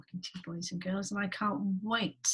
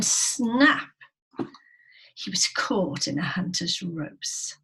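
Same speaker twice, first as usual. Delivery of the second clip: loud, animated storytelling; story read to children; 3 semitones higher, 8 dB louder than usual. A narrator's reading. Teacher telling pupils of lion and trap, animated loud narration. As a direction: punch up the drama for young listeners.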